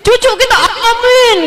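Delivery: high, laughing amen; high laughing amen for a grandchild; laughing mock amen. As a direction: chuckle through a hopeful amen. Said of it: "Kayak Aminah tuh gini ya".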